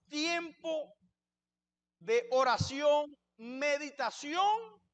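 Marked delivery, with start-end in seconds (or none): 2.0-4.8 each word drawn out slowly; deliberate, very slow listing; slow emphasis. Shown as a demonstration. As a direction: lay out each item slowly, stressing it. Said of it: "por qué enfatizo la palabra".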